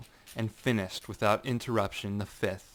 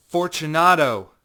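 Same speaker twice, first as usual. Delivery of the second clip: loud, much higher shout; calling out; 7 semitones higher, 14 dB louder than usual. Acted. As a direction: call his name into the dark. Calling into the niche.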